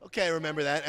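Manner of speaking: sudden realization. Retold or imitated. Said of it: "and I went".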